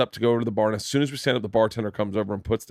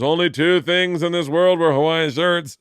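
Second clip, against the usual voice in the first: rising higher and louder into a teasing jab; mock Attell heckle; 5 semitones higher, 5 dB louder than usual. Attell needling a friend about his shirt, mock heckle. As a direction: hit him with the punchline, pitch climbing. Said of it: "and he goes".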